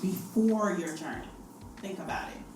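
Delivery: unvoiced, hushed urging; soft prompt. Real, not acted. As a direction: breathe it out gently. Host prompts reflection.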